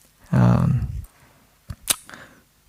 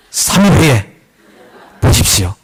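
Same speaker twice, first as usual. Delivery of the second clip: laughing. Pretend. Preacher jokes to congregation, laughing through it. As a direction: pretend confident prophecy through a laugh. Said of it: "딱 하고 싶은데".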